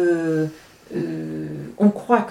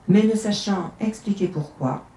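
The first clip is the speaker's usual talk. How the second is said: usual speaking voice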